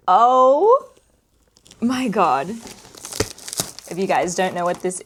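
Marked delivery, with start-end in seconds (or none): 0.0-2.5 high gasp, then a loud oh my God; shrieking jackpot winner. Real, not acted. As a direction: scream with joy at the prize. Holding her kits for first time.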